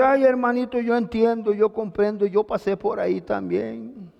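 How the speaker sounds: a touch of syrupy pity from a comforter. Retold or imitated. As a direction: coo over him, mocking the pity. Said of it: "Y llega otro y le dice". (el hermano lastimero, con compasión empalagosa)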